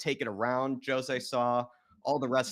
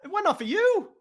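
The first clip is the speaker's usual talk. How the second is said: laughing; 6 dB louder than usual. Real, not acted. Laughing at comic miss.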